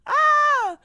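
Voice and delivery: high delighted shriek; high squeal of laughter. Real, not acted. Vlogger squealing at a fan's thirsty tweet.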